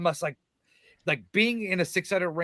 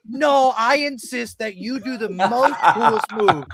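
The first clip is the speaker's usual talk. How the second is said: higher, laughing, overly polite; mock a fussy gentleman through laughter; laughing mock insistence; 7 semitones higher, 4 dB louder than usual. Mocking wrestlers' polite arguing.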